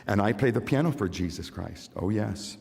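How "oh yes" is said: normal talking voice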